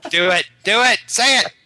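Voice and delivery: high, loud urging that climbs; loud goading. Real, not acted. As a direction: egg them on, rising with each push. Goading a reluctant friend to tell.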